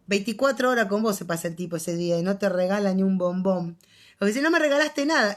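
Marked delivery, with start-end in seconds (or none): 4.4-5.4 wife scolding husband over a forgotten birthday gift; reproachful complaint. Retold or imitated. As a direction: throw the accusation at him, hurt.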